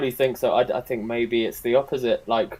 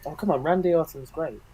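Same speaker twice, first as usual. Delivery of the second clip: soft, higher-pitched deadpan sarcasm; sarcastic; 4 semitones higher, 6 dB quieter than usual. Real, not acted. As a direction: say it softly, as if sincerely protesting. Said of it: "you can't get any of my sarcasm".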